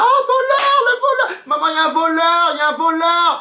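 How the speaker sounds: cri suraigu et fort, joué; faux cri au voleur. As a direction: crier au voleur comme une alarme feinte. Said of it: "j'étais déjà prête à dire". (faux cri d'alerte au voleur, très aigu)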